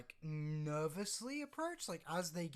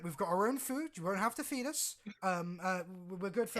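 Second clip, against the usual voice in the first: higher, louder, hurried assurances; eager, raised-voice persuasion; villager pleading her case; 5 semitones higher, 7 dB louder than usual. Acted. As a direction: talk them into taking you along. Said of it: "step forward at this point and say".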